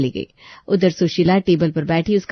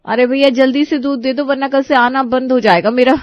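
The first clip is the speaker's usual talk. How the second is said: higher, urgent plea; hurried bride; 5 semitones higher, 5 dB louder than usual. Acted. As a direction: rush the shopkeeper. Preeti hurries milkman.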